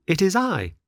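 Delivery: put-on high boy puppet voice; Pinocchio voice. A narrator's reading. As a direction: voice the eager puppet. Pinocchio answers.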